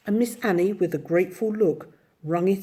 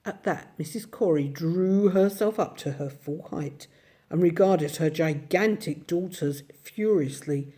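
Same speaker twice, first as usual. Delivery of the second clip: plain reading voice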